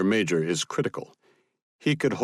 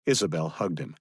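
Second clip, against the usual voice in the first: plain reading voice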